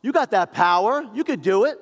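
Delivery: scornful thief daring Jesus off the cross; mocking taunt. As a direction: goad him like a bully. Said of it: "one is mocking him".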